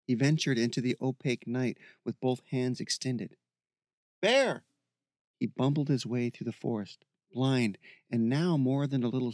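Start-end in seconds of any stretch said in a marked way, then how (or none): 4.2-4.6 high, searching shout; calling out. Acted. Michael searching for Bear in the forest, calling out. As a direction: shout the name into the dark.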